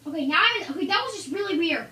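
loud, excited yell into the mic; loud confused outburst. Real, not acted. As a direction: shout it out, startled. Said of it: "you don't need to yell".

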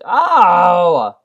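loud high startled oh; startled cry. Real, not acted. Slipping off the edge mid-run, startled loud cry. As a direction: yelp as you slide off the edge. Slides off ledge in game.

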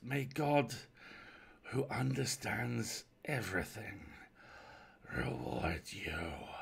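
slow blessing sinking into a whisper; husky stranger overcome with gratitude; husky, breaking off. Acted. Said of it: "It broke off huskily".

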